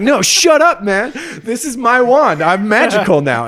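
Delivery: high, loud acted defiance; defiant mock shout. Short friend defending his new wand, high defiant shout. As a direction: shout it like a tiny friend refusing advice.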